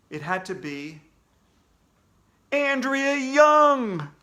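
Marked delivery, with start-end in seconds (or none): 2.5-4.1 slow, high, loud cry of dread; horrified realisation. Acted. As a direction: blurt the name in horror. Boy realises who it is.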